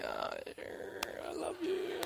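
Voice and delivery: squeaky hushed falsetto; mock reply to Sandy. Pastor mimics his own reluctant reply to wife, squeaky falsetto. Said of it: "And I say".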